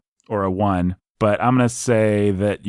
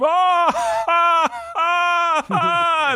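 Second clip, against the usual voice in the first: mock scream; much slower than usual. Mocking over-the-top film scream.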